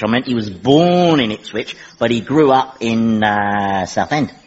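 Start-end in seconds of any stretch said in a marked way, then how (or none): 0.0-1.8 "born" leaned on as a correction; stress on born. Acted. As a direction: lean on "born" to set it apart. Corrects himself, contrasting born with from.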